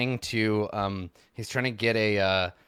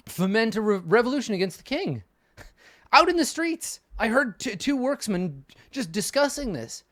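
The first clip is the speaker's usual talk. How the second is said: agitated rant; 11 semitones higher, 4 dB louder than usual. Acted. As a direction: voice pitched much higher and louder, worked up. Reza ranting about revolution.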